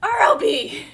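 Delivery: loud, high shocked yelp. Real, not acted. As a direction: gasp out loud in disbelief. Shocked by more gifts.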